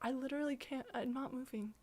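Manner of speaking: very soft, unimpressed murmur; deadpan reply. Retold or imitated. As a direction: drop right down, refusing to react. Deadpan answer to failed scarers.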